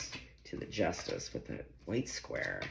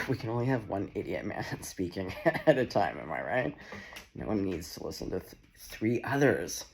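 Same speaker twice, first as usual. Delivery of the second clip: ordinary talk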